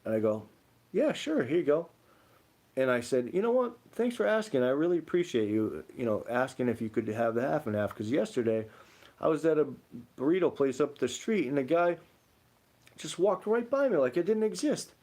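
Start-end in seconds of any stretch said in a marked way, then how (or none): 0.9-1.9 much higher, bright offer; high friendly reply. Retold or imitated. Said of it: "when I said it like this". 3.3-14.9 higher, pointed thank-you; pointed remark. Retold or imitated. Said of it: "I looked the guy dead in the face when I said it like this".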